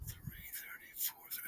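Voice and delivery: softly counting hours under breath; whispered counting. Retold or imitated. Wife whispers to herself, counting hours.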